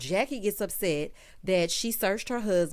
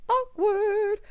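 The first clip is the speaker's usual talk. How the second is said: high-pitched, louder comic "awkward"; awkward aside; 13 semitones higher, 5 dB louder than usual. Real, not acted. Host reacts to Jennifer walking in on the group, high mock-awkward aside. Comments on Jennifer's unexpected arrival.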